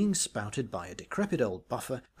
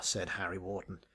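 plain reading voice

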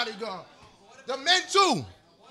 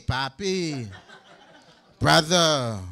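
sheepish shopkeeper; 8 semitones lower, much slower than usual. Retold or imitated. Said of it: "Same thing, Papi".

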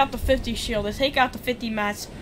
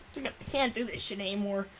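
ordinary delivery

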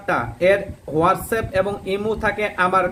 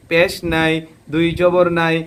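ordinary talk, nothing marked

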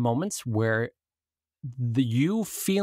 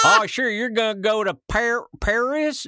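put-on cowboy accent; 9 semitones higher, 6 dB louder than usual. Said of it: "like a cowboy trying to pronounce Paris".

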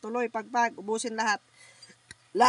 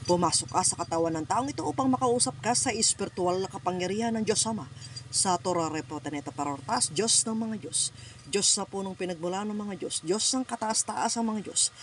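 ritual invocation prayer; 3 semitones lower than usual. Real, not acted. Healer prays, invoking spirit.

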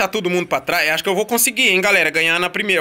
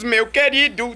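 ordinary delivery

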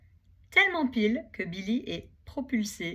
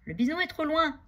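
higher, louder call down; shout; 8 semitones higher, 5 dB louder than usual. Acted. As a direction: keep shouting from the branch. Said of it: "crie-t-il". (appel crié et aigu)

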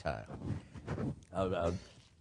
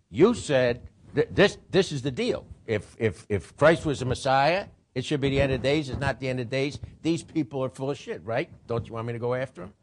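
higher, louder, insistent argument; Paul pleading; 6 semitones higher, 5 dB louder than usual. Retold or imitated. Paul pressing the priests to let him go after them, insistent. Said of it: "He says".

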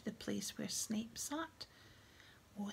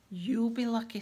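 loud, crackly poltergeist jeer; a mischievous poltergeist jeering at the headmaster in a crackle; crackling voice; 9 dB louder than usual. Acted. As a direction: crackle it out gleefully. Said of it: "said a crackling voice".

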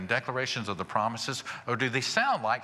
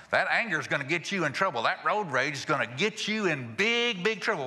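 high, scolding mock wife; hammed-up warning, pitched up with punched words; nagging warning; 5 semitones higher than usual. Wife warning her husband about road rage, mock scolding.

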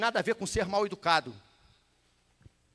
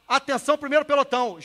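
loud, raised platoon call; command voice; 5 semitones higher, 8 dB louder than usual. Acted. Squad leader warns the platoon an order is coming, command voice. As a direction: call the first platoon to attention. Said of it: "Quando o cara fala lá".

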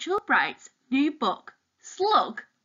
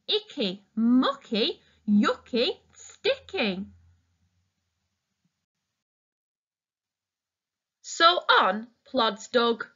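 put-on reading to children. Reading picture book to children.

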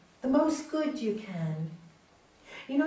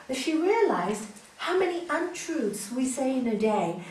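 usual speaking voice

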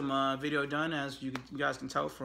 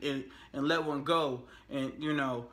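ordinary talk

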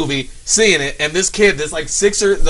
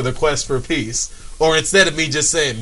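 ordinary delivery

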